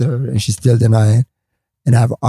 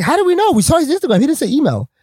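pitched way up, baffled and indignant; high incredulous protest; 14 semitones higher than usual. Retold or imitated. Newcomers protesting the email rule to comics, high incredulous protest.

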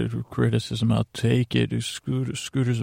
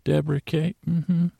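higher-pitched pretend teacher taking attendance; 6 semitones higher than usual. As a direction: put on a teacher voice for roll call. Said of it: "I gotta get my teacher voice on".

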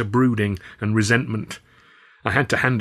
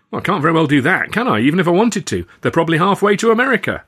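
a lightly voiced Alf, higher, louder and fast; Alf's voice; 7 semitones higher, 6 dB louder, faster than usual. Alf telling the lads he can't stop them, fast and loud.

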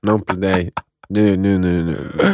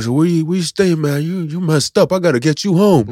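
higher-pitched American cabbie; concerned cab driver; 7 semitones higher than usual. Retold or imitated. Taxi driver to a drunk passenger, concerned American voice. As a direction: play the driver looking after a drunk. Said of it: "Han bara".

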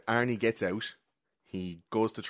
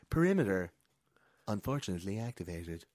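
automated announcement. Retold or imitated. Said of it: "it's like".

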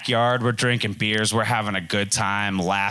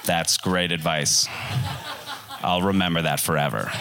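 sarcastic thanks; 4 semitones lower than usual. Retold or imitated.

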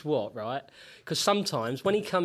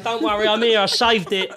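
loud entrance; 5 semitones higher, 11 dB louder than usual. Acted. Late arrival making loud entrance.

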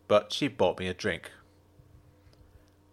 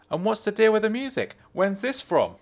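Jenna's voice; 10 semitones higher than usual. A narrator's reading. Jenna's voice in dialogue.

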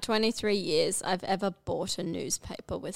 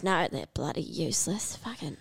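soft, lower grumble fading to a whispered curse; muttering grandmother; 6 semitones lower, 10 dB quieter than usual. Retold or imitated. Grandmother dismissing the Greens, a soft mutter ending in a whisper. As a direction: mutter it, then whisper the swear. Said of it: "my grandmother goes".

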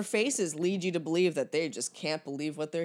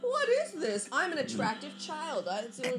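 high-pitched mock British child; put-on British accent, pitched way up; fake British accent; 7 semitones higher, 6 dB quieter than usual. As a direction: imitate a precious kid in a fake British accent. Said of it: "Is that a fake British accent?".

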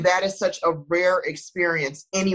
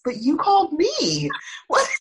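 higher, half-laughing mock confusion; baffled. Retelling her reply to his call with mock baffled questions, pitched higher. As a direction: act puzzled, pitch climbing with each what. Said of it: "And I'm like".

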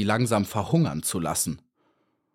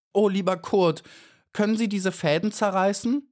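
high little bee voice, imploring; anxious pleading; 10 semitones higher than usual. A narrator's reading. Caught bee asks the beetle to tear the threads, pleading. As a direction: ask high and pleadingly, lifting at the end.

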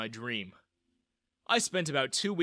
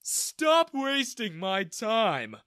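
dismissive; 8 semitones higher, 5 dB louder, much slower than usual. A narrator's reading. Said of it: "he yawned".

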